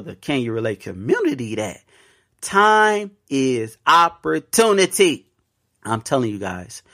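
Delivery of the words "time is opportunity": time is opportunity said slowly, each word weighted; slow motto. Real, not acted. Podcast host drilling his motto into listeners, slow and weighty. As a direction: lean on every word of the motto.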